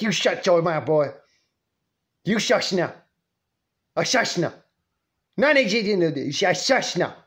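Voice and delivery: cutting off excuses with sharp shushes; scolding grandma. Retold or imitated. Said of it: "She'd say it like that".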